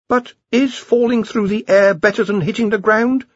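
high-pitched, anxious underling; nervous Ponder. A narrator's reading. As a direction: warn the boss in a worried high voice. Ponder in story.

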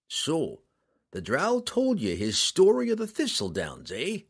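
a lightly voiced knowing ruffian; gruff captor's reply. A narrator's reading. Roddy taunts the girl he holds, a lightly voiced sly ruffian. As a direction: needle her with a sly, knowing tone. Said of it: "Roddy replied".

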